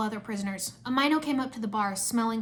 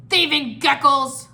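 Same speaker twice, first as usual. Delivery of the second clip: loud, drawn-out creature shout; angry shout; 3 semitones higher, 10 dB louder, slower than usual. A narrator's reading. Mert shouts back at the geckos, angry shout. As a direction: throw the insult back at full volume. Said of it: "Mert shouted".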